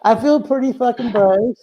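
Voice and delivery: usual speaking voice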